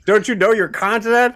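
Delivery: mock teasing. Real, not acted. Teasing the player's mistake.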